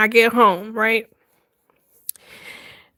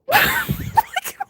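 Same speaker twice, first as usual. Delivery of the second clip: high laugh. Real, not acted. Laughing fit after the story.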